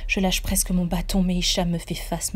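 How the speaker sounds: ordinary delivery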